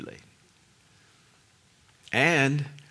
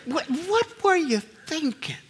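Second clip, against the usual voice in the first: amused disbelief; 10 semitones higher, 3 dB quieter than usual. Retold or imitated.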